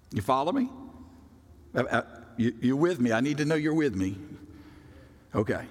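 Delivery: ordinary delivery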